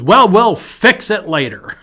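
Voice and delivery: high, loud, slow deadpan comeback; aunt's calm retort. Retold or imitated. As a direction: deliver the aunt's line big and slow. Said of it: "She goes".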